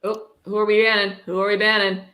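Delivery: ordinary talk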